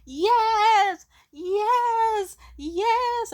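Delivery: high, loud giddy laugh, then yes yes yes; laughing and shouting for joy at the top of your lungs; ecstatic yelling. Retold or imitated. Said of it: "at the top of my lungs".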